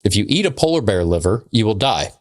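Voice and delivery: normal talking voice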